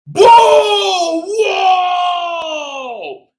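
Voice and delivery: loud startled whoas; hammed-up scare for kids; mock fright. Spider jump scare in story.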